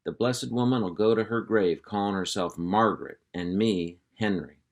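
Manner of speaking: ordinary delivery, nothing marked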